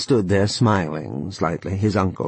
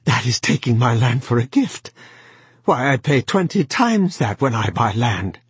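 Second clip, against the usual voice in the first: cried out; 4 semitones higher than usual. A narrator's reading. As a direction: protest in disbelief. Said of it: "he cried".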